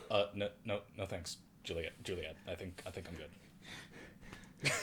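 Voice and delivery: quiet, hesitant mumbled refusal; Kristen Stewart impression. Host imitating an actress declining a drink, quiet and hesitant. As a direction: trail off shyly, stammering. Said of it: "my Kristen Stewart impression is pretty spot on".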